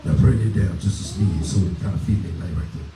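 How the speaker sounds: deep, low street voice. Pretend. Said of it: "Why your voice get so deep?".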